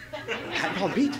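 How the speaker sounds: high laughing list. Real, not acted. Realizing the mix-up, high laughing. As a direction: giggle through the names.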